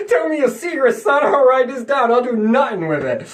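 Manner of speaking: high, loud put-on voice; hammed-up spy coaxing. Acted spy coaxing out secrets.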